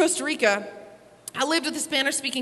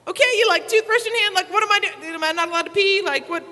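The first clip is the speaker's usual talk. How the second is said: confused surprise; 6 semitones higher, 3 dB louder than usual. Retold or imitated. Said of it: "and I'm like".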